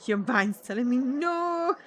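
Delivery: put-on slow, lightly Scottish accent on the no. Joking with the game choice, in a slow slight Scottish accent. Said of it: "I said the no with a Scottish accent".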